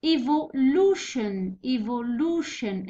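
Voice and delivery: evolution said slowly, 'lu' drawn out; stress on LU. Shown as a demonstration. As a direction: stretch the second-last syllable of evolution. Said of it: "ستريس يجي في البانالتيميت سيليبل".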